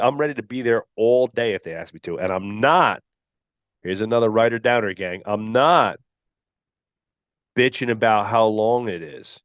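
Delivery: usual speaking voice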